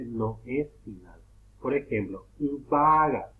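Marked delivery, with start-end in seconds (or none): 2.7-3.3 vaga with a loud, drawn-out first A; stressed A. Shown as a demonstration. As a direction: lean hard on the A in the middle of vaga. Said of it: "lleva acento la A porque no es final".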